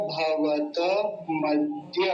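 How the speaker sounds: भवत मध्य with the full त running into मध्य; correct joined pronunciation. Shown as a demonstration. Teacher giving the correct form of the mantra word. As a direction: say भवत मध्य with त fully sounded. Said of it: "तो क्या हो जाएगा".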